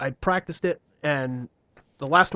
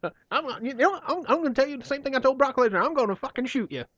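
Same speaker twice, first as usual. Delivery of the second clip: high, angry Southern promoter rant; Jim Cornette impression; 12 semitones higher than usual. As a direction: rant like a cranky old wrestling manager. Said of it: "A new character has joined the cast".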